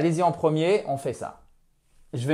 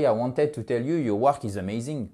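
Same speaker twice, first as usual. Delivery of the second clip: flat French accent; 2 semitones lower, 4 dB quieter than usual. Shown as a demonstration. Teacher showing what to avoid, flat French-accented English. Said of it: "le français, c'est plat".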